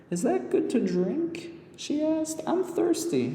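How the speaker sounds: put-on high young girl asking; little girl pointing at the spring; girl character voice. A narrator's reading. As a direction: ask innocently like a thirsty child.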